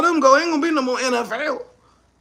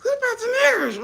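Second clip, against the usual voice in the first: much higher and fast, disbelieving echo; incredulous repeat; 10 semitones higher, faster than usual. Retold or imitated. Comedian echoing the boardroom suggestion in disbelief, high and fast. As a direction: throw the question back in shock. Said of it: "somebody said".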